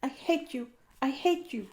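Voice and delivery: high, hurt and furious, over and over; a girl's high-pitched tantrum at her critic; repeated angry cry. Acted. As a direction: hammer it home like a wounded child. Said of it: "cried Anne".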